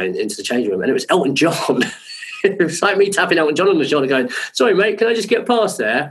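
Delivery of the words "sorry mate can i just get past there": much higher, meek-sounding request; polite squeeze-past. Retold or imitated. Tapping [name]'s shoulder backstage, high apologetic request. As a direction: pitch it way up, apologetic. Retold request to big star blocking way.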